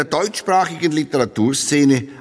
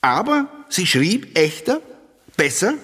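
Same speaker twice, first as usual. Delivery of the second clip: higher, gushing critic's praise; mock enthusiastic quote; 7 semitones higher than usual. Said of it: "ich zitiere".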